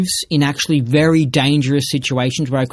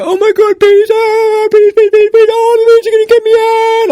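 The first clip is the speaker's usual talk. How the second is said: much higher, louder hammed-up squealing panic; mock freak-out; 9 dB louder than usual. Mocking the scared girl, much louder high shrieking panic. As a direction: squeal like bees are swarming you. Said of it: "she'll go".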